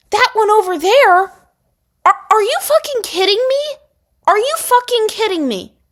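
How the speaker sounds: high, loud indignant outburst; outraged disbelief. Retold or imitated. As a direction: let the outrage burst out. Outraged retelling of the insult.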